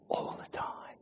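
unvoiced whisper on "all the time". Preacher whispering "all the time" to the congregation. Pastor whispers the point home.

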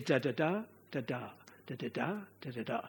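"da-da-da, da-da" in quick soft groups, last beat of each held; Kina rhythm. Shown as a demonstration. Preacher contrasts Kina rhythm with the iambic, soft grouped beats. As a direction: patter "da-da-da" low and soft, landing on the third beat. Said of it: "Now the Kina rhythm is".

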